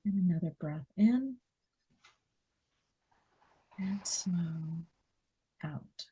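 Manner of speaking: quiet breathing coach; guided breathing. Acted. Guiding breathing, softer.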